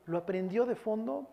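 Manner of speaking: ordinary talk, nothing marked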